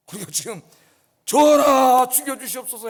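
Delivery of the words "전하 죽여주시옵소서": humble plea. Acted. Subject begging the king to forgive, pleading. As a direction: throw yourself at the king's mercy.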